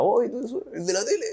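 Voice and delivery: high, excited stranger voice; excited fan. Retold or imitated. Stranger on the street recognizing the TV singer, high excited fan voice.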